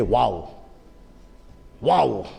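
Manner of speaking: low, mock-awed bystander; lightly voiced impressed onlooker. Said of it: "might look at this and say".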